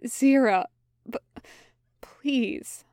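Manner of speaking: breaking into a whispered plea; begging his friend as his voice catches; pleading, voice catching. A narrator's reading. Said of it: "Crowley pleaded, and his voice caught".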